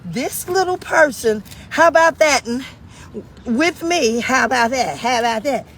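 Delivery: mock outrage, punched out loud; retold indignation. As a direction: act out being taken aback by a cheeky child. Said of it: "I was like".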